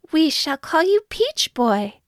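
put-on high-pitched old woman naming her child; high storybook old-woman voice; joyful old woman. A narrator's reading. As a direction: proclaim it high and happily. Old woman names the boy.

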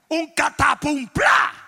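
drawn-out crash word with a burst at the end; explosive sound effect. Shown as a demonstration. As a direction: boom it out slowly like a crash. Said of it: "Mira qué efecto especial tan poderoso".

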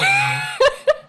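high, squealing burst of laughter; shrieking laugh. Real, not acted.

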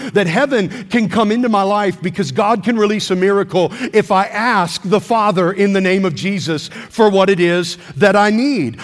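normal talking voice

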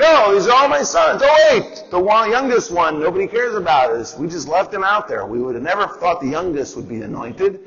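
high, flustered father; a father caught off guard, brushing it off; Jesse flustered. Retold or imitated. Jesse dismissing his youngest son, high and flustered. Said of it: "And Jesse's like".

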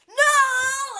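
high, loud objecting yell; shouting no. Retold or imitated. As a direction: cry it out in protest. Leo objects at wedding.

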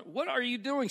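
mock bewilderment at being shoved; baffled protest. Narrator to shoving cousin while serving, baffled.

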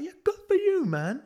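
hammed-up pub cheerleader; mock hype. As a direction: cheer a mate into going out drinking. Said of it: "I was like".

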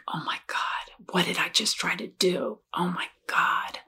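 hushed, near-whispered retold horror; quiet retelling of bawling to oneself; retold tearful disbelief. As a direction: whisper it in stunned disbelief, drawing out the last words. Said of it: "just started bawling like".

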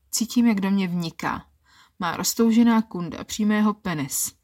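usual speaking voice

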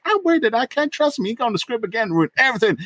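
high mock fretting voice; mock worry. Hope doubting Scotty, high mock fretting. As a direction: fret in a hammed-up voice. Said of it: "Hope's all like".

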